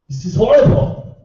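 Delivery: ordinary delivery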